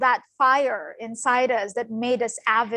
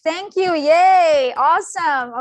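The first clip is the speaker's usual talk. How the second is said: loud, higher-pitched cheer; excited cheering; 5 semitones higher, 8 dB louder than usual. Real, not acted. Cheering the volunteers after the reading.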